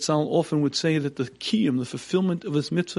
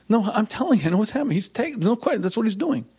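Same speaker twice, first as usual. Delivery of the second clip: insistent; 6 semitones higher, faster than usual. Retold or imitated. Mind insisting loudly.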